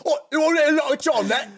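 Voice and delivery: high, hammed-up British offer; kid's fake British accent, offering Lucky Charms; mock British. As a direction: hold up the cereal like a cheeky British lad. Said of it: "We talked like British people".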